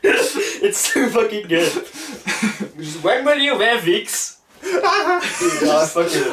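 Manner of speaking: slow, high laughing through words; cracking up, barely getting words out; real laughter. Real, not acted. Fan cracking up replaying the interviewer's wig question, laughing hard.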